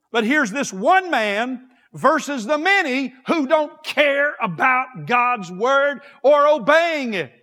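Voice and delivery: forceful preaching. Acted. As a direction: press the point hard from the pulpit. Preaching about the lost world.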